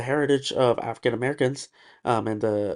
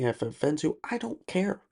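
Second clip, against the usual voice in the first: ordinary talk, nothing marked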